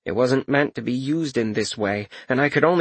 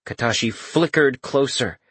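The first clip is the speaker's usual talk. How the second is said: ordinary delivery, nothing marked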